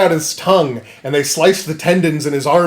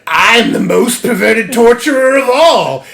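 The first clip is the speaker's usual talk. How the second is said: creepy mutant voice; 8 semitones higher, 6 dB louder than usual. Retold or imitated. Deformed mutant torturer speaks.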